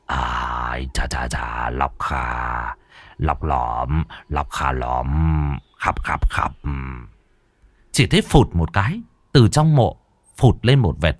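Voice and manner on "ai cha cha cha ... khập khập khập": much lower, softer, slow chant; mumbled incantation. A narrator's reading. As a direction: mutter the spell slowly under the breath. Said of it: "ngồi lẩm bẩm".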